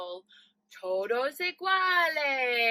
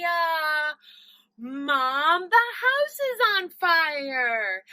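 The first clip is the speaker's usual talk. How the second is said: ordinary talk, nothing marked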